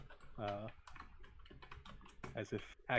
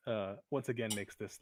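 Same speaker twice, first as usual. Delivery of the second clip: ordinary delivery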